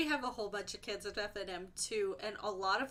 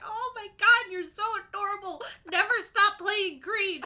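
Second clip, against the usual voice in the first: high, loud, laughing coo; adoring gush; 8 semitones higher, 9 dB louder than usual. Retold or imitated. Adult reacting to cute little player, high adoring gush. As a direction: gush over an adorable kid. Gushing at an adorable kid.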